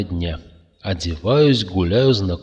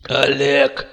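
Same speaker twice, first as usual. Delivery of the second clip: higher, strained dying croak; wheezing; 6 semitones higher than usual. A narrator's reading. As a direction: rasp out the name. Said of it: "прохрипел Макс". (предсмертный хрип)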